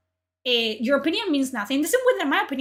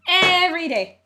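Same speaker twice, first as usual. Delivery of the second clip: Every day shouted higher and louder, strained; bigger exasperated outburst; 5 semitones higher, 6 dB louder than usual. Real, not acted. Ranting streamer fed up with people, strained shout. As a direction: push Every day up into a near yell.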